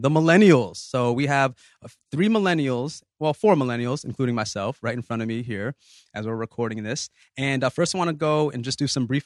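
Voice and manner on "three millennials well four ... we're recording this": ordinary talk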